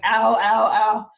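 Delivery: loud, hammed-up ows; mock pain cries. Storyteller as Tyr losing his hand, mock pain cries. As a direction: yelp ow as pretend pain.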